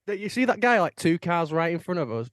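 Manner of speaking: high, loud angry explaining; angry girlfriend. Retold or imitated. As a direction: scold the other car through the windscreen. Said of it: "And she went".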